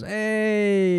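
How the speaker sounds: put-on high, loud Italian-accented greeting; Italian guy greeting. Imitating Italian guys greeting him, Italian accent. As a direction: sing out a hammed-up Italian hello. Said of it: "Every Italian guy goes".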